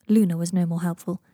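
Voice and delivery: usual speaking voice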